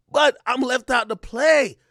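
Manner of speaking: normal talking voice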